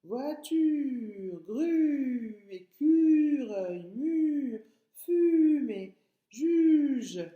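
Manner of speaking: each word called out loud with a stretched U; exaggerated U sounds. Shown as a demonstration. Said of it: "famille de tortues".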